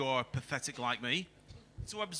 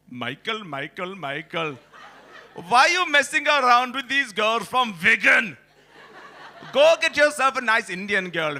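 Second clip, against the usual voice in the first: uncle doctor; 4 semitones higher, 5 dB louder than usual. Retold or imitated. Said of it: "And he said, word for word".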